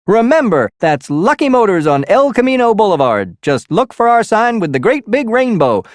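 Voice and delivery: high, hammy, pushy car dealer pitch; car salesman radio ad. Acted. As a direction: sell it like a cheesy car-lot ad. Radio ad for used car lot.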